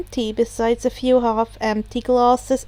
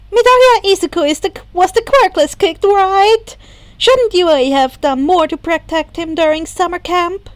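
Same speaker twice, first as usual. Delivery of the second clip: higher, louder and fast, mocking a reporter; 6 semitones higher, 6 dB louder than usual. A narrator's reading. Izuku mimics the reporters on screen, mocking, higher and fast. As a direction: sneer it out as a nosy reporter, rushed. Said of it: "spoke over them in a mocking tone".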